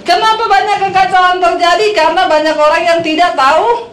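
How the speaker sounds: high, forceful prompting of the crowd; loud call-out. Shown as a demonstration. Preacher prompts congregation to answer loudly, loud high call-out. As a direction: call it out strongly for listeners to finish. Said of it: "Yang kenceng ngomongnya".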